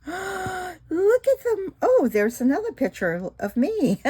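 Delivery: high awed look at them; amazed. Real, not acted. Gasp at pictures.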